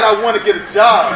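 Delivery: high, loud and energetic; loud model take. Shown as a demonstration. Teacher answers the class with a loud, high model line. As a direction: say it like you mean it, full energy. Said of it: "That's right".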